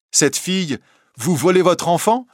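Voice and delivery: high, incredulous question; Gervaise. A narrator's reading. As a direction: doubt the mother's fear. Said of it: "dit Gervaise". (Gervaise incrédule, voix aiguë)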